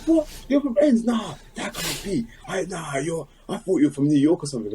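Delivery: high, put-on voice of a doubting fan; disbelieving fan. Gym fan refusing to believe he's from South London, high put-on voice. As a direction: play the fan who won't buy it.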